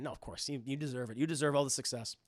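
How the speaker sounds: usual speaking voice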